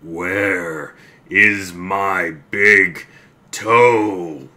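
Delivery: very slow, loud moan; groaning ghost. Acted. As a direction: moan it right outside the door. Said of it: "the voice groaned".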